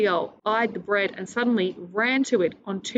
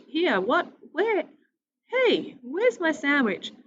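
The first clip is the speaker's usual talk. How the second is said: much higher, rising panic; alarmed surprise; 8 semitones higher, slower than usual. Acted. Sophie finds her sandwich gone, high alarmed surprise. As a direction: search frantically for the lost lunch.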